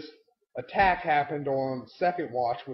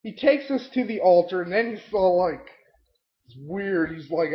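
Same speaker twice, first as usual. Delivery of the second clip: ordinary talk, nothing marked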